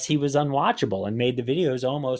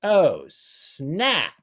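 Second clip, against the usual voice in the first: mock-excited, louder "Oh, snap"; imitating the snap guy; 5 semitones higher, 5 dB louder than usual. Host mocking the fan's reaction, mock excitement. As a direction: parody the fan finally earning it. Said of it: "And then on this trick".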